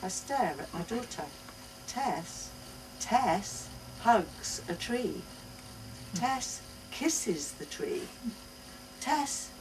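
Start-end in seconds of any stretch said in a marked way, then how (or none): none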